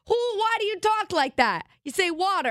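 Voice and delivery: a mocking high-pitched relative; mocking family. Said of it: "they're like".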